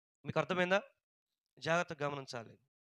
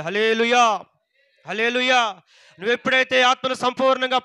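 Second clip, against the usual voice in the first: loud, high, driving sermon delivery; impassioned pulpit proclamation; full-force preaching; 4 semitones higher, 11 dB louder than usual. Real, not acted. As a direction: preach it hard, phrase upon phrase, voice raised.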